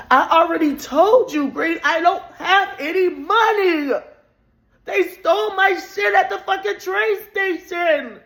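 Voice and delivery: high, loud shouting; frantic, furious tearful shout; belligerent yelling. Retold or imitated. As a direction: yell it at him, voice cracking up high. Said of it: "And so I'm belligerent".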